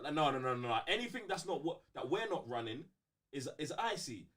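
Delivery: ordinary delivery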